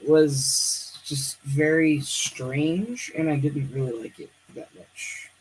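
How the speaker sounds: ordinary talk, nothing marked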